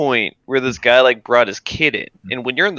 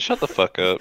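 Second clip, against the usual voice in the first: normal talking voice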